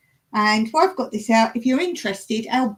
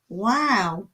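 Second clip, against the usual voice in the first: ordinary talk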